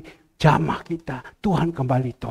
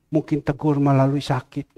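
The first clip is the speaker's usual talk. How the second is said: normal talking voice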